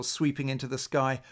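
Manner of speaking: ordinary delivery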